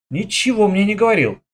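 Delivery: usual speaking voice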